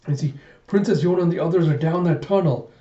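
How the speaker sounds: ordinary talk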